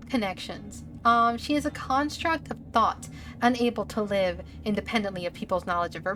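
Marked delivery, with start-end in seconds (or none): none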